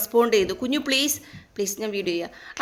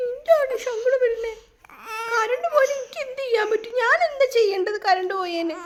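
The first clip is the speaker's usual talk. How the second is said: put-on whiny baby-talk plea; 11 semitones higher, 5 dB quieter than usual. Mother pleads with crying babies.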